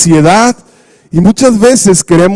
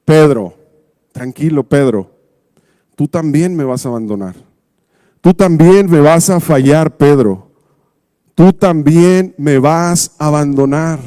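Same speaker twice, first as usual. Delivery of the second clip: calm Jesus; 4 semitones lower than usual. Retold or imitated. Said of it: "vemos que Jesús le dice".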